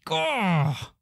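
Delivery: high exasperated "gah"; frustrated groan. Acted. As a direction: groan in mock frustration at being shut down. Mock frustration at being cut off.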